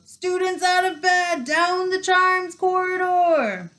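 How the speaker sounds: high sustained town-crier holler; bellowing. A narrator's reading. Peeves gives away the pupils' hiding place, bellowing. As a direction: stretch it out so the whole castle hears. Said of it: "Peeves bellowed".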